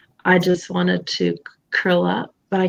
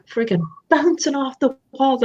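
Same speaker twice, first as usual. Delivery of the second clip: mock giddy; 5 semitones higher than usual. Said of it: "I would be, like".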